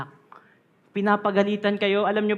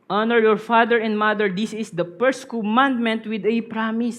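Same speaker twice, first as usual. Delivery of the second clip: louder emphatic Bible reading; scripture reading; 2 semitones higher, 5 dB louder than usual. Acted. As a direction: proclaim the commandment. Reads scripture aloud.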